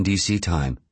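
ordinary delivery, nothing marked

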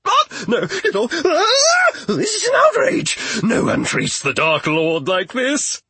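a pompous villain losing it; outraged squeal. Acted. Wizard squeals covered in moths.

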